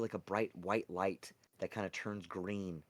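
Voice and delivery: usual speaking voice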